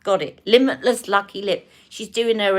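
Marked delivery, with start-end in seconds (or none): none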